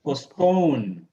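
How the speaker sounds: "Postpone" as post-PONE, long falling second syllable; stress on second syllable. Shown as a demonstration. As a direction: draw out and punch PONE in "Postpone". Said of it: "The second one is stress".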